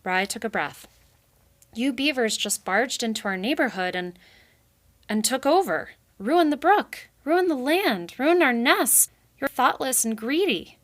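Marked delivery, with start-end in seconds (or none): 1.7-10.8 higher, louder accusing rant; angry outburst. Acted. Rai ranting at Clara the beaver. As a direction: build it up, hurt and furious.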